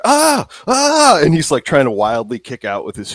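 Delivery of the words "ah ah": two high, loud cries; high-pitched "ah" screams in a scuffle; struggling yells. Acted. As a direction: yelp while wrestling with attackers. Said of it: "you just hear".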